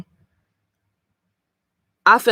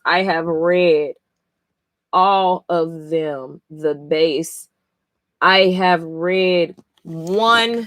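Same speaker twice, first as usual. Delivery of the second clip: slow, deliberate; much slower than usual. Real, not acted. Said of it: "slow deliberate emphasis".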